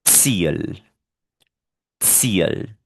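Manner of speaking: "Ziel" with initial ts, as tseel. Shown as a demonstration. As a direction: model "Ziel" alone, ts at the start. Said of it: "La T con la S suenan".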